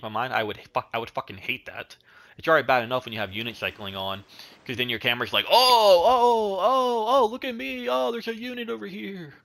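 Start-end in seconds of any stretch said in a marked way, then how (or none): 5.5-9.3 grandpa voice. Retold or imitated. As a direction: imitate a fussy grandpa excitedly pointing things out. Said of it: "That was my, like, grandpa voice".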